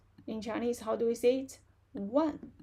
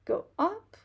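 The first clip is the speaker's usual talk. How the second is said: higher, louder, rising demonstration; pitch going up; 7 semitones higher, 4 dB louder than usual. Shown as a demonstration. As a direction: voice climbs high on "up". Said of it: "change to a rising tone".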